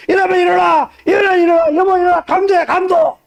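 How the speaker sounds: high, loud panicked calling; father rousing his wife about a burglar, alarmed shouting. Retold or imitated. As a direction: shout the family awake in alarm. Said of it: "하고 소리 질러서".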